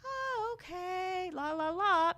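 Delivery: high, airy brush-off, ignoring the complaints; sing-song la la la, carefree and unbothered; breezy dismissal. Retold or imitated. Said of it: "we're just like".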